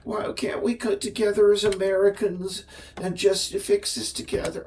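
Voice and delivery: mock Southern caricature. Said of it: "Southern accents to most of the, my caricature voices".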